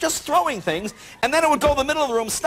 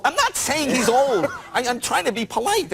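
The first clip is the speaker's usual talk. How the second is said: exasperated protest; 2 semitones higher than usual. Retold or imitated. Guest defending himself to the translator, exasperated. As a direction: stretch out the denial in exasperation.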